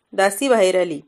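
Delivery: ordinary delivery, nothing marked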